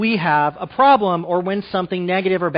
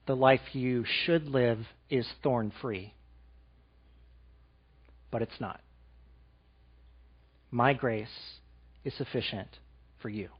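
much softer and lower, fading out; fading to a hush; 7 semitones lower, 10 dB quieter than usual. Real, not acted. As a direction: drop to near silence on for you.

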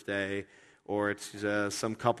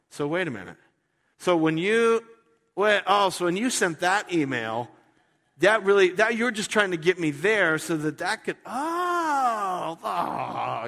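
rising, choked-up realization; moved to tears; 7 semitones higher, 6 dB louder than usual. Retold or imitated. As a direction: let the voice crack as it dawns. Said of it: "the person's sitting there going".

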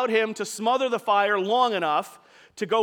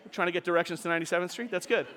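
softer, fast, laughing at the phone; laughing aside; 4 semitones lower, 6 dB quieter, much faster than usual. Real, not acted. Preacher laughing at Siri butting in, fast soft aside. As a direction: chuckle through the interruption. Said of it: "Siri is enjoying my sermon".

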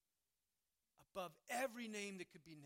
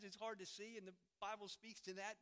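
ordinary talk

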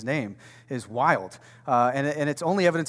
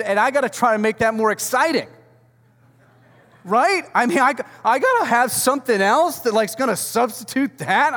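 much higher and louder, mock exasperation; loud exasperated preaching; 8 semitones higher, 6 dB louder than usual. Real, not acted. Pastor joking to church about Easter sermons, loud mock exasperation. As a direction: throw up your hands in comic frustration.

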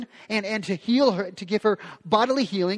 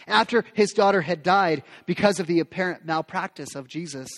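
ordinary talk, nothing marked

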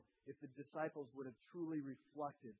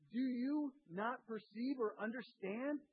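higher, slower, each word pressed; slow emphatic rebuke; 7 semitones higher, 3 dB louder, slower than usual. Acted. Preacher voicing Jesus scolding the disciples, slow and emphatic. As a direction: lean on every word like a rebuke.

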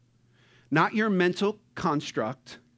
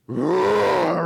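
loud full lion roar; big roar; 3 semitones higher, 7 dB louder than usual. Retold or imitated. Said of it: "And then he goes".

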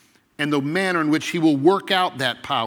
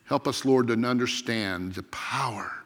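opening prayer; 4 semitones lower than usual. Real, not acted. Pastor leads opening prayer.

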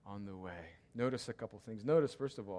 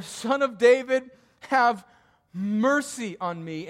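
loud, high, desperate plea; crying out; 9 semitones higher, 14 dB louder than usual. Acted. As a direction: shout it louder, begging. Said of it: "he cried out all the more".

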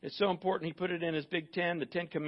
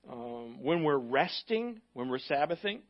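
ordinary delivery

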